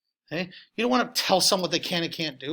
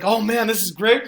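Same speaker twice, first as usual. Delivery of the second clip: mock delight; 4 semitones higher, 10 dB louder, slower than usual. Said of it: "I was like".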